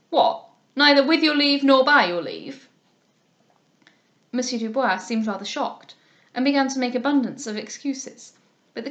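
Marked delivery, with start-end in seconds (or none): 0.1-2.7 loud, indignant challenge at the coach door; the captain challenging. A narrator's reading. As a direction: bark it like a blustering captain.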